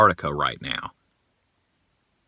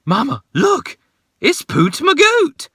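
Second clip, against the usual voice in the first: put-on high excited child voice, much higher; imitating little daughter; 4 dB louder than usual. Dad imitating his daughter spotting Poot Magoot, high excited child voice. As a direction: squeal it like a thrilled little girl. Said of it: "my daughter stood up and went".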